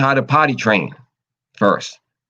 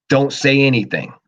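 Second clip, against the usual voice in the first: ordinary talk, nothing marked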